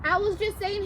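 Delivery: usual speaking voice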